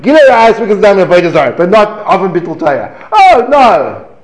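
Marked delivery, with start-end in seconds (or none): none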